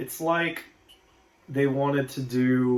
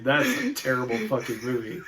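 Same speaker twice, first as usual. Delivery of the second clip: ordinary delivery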